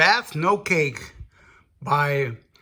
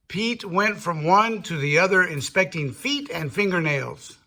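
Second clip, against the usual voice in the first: usual speaking voice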